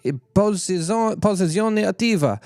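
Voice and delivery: hammed-up Italian accent, pitched high. Streamer attempting Italian card text, in an Italian accent. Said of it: "I'm doing this accent".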